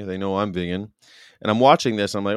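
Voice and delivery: ordinary talk, nothing marked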